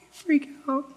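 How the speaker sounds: tiny, soft, high "freak out"; hushed freak-out. Shown as a demonstration. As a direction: say "freak out" in a small hush. Mock meek freakout, whispered.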